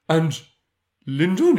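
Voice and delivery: high, loud, eager question; acted excitement. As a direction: ask it as an excited character hungry for news. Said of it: "He's so excited".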